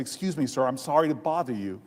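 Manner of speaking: low, polite apology to a stranger. Retold or imitated. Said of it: "so I lowered my tone".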